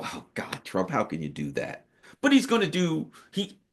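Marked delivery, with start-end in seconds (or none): 0.0-1.9 quiet dismayed sigh. Retold or imitated. Imagines own future dismay at Trump.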